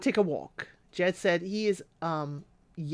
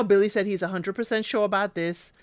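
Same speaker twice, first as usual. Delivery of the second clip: normal talking voice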